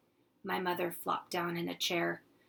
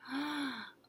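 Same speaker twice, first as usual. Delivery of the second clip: gasp; 7 semitones higher, 5 dB quieter than usual. Acted. Children see the turtle.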